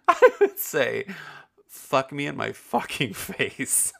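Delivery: said through real laughter; cracking up while saying it; laughing. Real, not acted. Teen telling friends the line, laughing hard. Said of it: "I would say the words".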